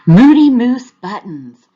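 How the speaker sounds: loud laughing announcement; storybook zebra laughing as he announces. A narrator's reading. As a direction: burst out laughing with the news. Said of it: "laughed Zebra".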